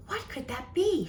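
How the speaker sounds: hushed, curious little question; wondering softly. Acted. Liddy wonders about the smell in her room, hushed curious voice. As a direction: wonder aloud in a soft voice. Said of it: "she wondered".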